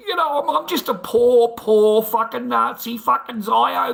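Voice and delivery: high, loud, pleading mock whine; mocking whiny victim. Streamer mimicking a chatter begging for protection, high mock whine. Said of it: "have a fucking whinge and have a cry".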